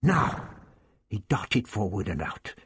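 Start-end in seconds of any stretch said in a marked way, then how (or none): 0.0-0.5 quick, higher call of "now"; sudden exclamation. Acted. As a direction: snap out the signal to attack. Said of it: "suddenly exclaimed".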